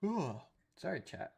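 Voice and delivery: mid-yawn, high and stretched. Real, not acted. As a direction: yawn through the words. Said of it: "Yawning there".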